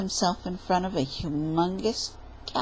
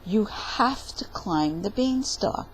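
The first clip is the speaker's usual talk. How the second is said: ordinary delivery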